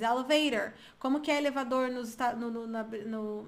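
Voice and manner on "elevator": "Elevator" with American tapped t and final r; American accent. Shown as a demonstration. Teacher giving the US word for elevador, American accent. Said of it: "como que é elevador nos Estados Unidos".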